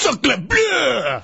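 over-the-top stage French exclamation; French accent. Acted. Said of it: "I guess that was his French accent".

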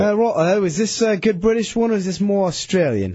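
high-pitched attempt at a British accent; British accent that strays toward Australian. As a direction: put on a wobbly, questionable British accent. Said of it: "give us your best British impersonation".

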